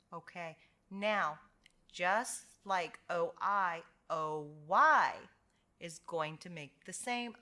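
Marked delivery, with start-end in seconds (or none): none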